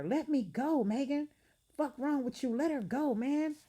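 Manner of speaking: high, loud frantic shout; panicked shouting in a brawl. Acted. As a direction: yell to be let go. Fight being broken up.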